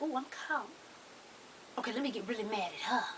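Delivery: put-on hushed plotting boyfriend, rushing it; mock scheming boyfriend. Caught man plotting fake anger.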